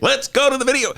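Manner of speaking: high announcer call of the catchphrase; hammed-up sports anchor tossing to tape; mock TV sportscaster voice. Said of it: "Remember there was a guy who used to do that?".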